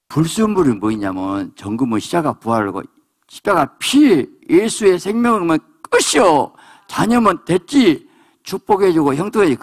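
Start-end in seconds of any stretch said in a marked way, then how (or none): 3.3-9.6 high, forceful preacher's declaration; emphatic preaching. Real, not acted. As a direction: proclaim it high, phrase by phrase. (높은 소리로 단호하게 선포하듯)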